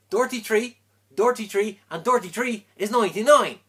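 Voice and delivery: loud, high Irish lilt for the punchline; loud triumphant Irish brogue; Irish accent. Acted. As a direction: belt it out in a thick Irish brogue. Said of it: "said the Irishman".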